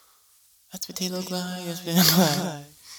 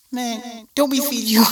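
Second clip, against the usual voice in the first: exasperated scolding; 10 semitones higher, 6 dB louder than usual. Retold or imitated. Scolding the dog.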